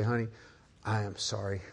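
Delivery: quiet, gentle, sincere sorry; soft apology. Retold or imitated. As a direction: tell your wife you're sorry, softly. Retold apology to his wife.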